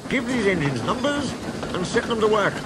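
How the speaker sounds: high-pitched command; ordering. A narrator's reading. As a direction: give the order as the boss. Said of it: "he ordered".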